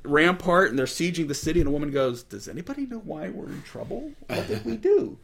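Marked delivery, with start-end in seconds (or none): 2.2-5.2 high, bewildered woman's voice; puzzled townswoman. Retold or imitated. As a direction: wonder aloud what they did wrong. Townswoman asks what's wrong.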